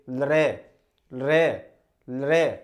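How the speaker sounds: लृ said firmly with tongue on teeth; vowel demo. Shown as a demonstration. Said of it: "उच्चारण नहीं कर सकते".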